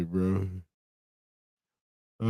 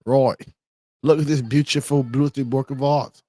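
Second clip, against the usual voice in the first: exaggerated British accent, higher pitched; 5 semitones higher, 4 dB louder than usual. Streamer mimicking British people from a meme, mock British accent. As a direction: ham up the British vowels, higher and punchy. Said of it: "British people be like".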